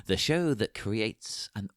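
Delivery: grand show-opening announcer read. Acted. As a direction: hail the citizens of Gotham like a herald. Host opens the show.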